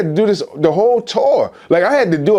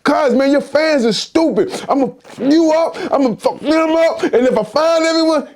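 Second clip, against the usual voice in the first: high-pitched indignant ranting; retold angry rant; 9 semitones higher than usual. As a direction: act out losing it at someone's fans. Retold angry phone argument with [name].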